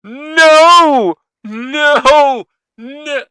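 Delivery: sudden loud shocked yells; shocked outcry. Real, not acted. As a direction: blurt out in real fright. Reacting to scary animal video.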